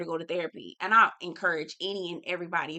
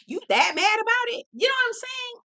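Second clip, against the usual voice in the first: pitched way up in disbelief; high incredulous repeat; 3 dB louder than usual. Retold or imitated. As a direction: ask it again much higher, mock shocked. Repeating question to the son.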